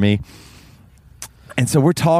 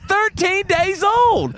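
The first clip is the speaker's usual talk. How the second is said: high insistent repeat; much slower than usual. Retold or imitated. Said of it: "She said".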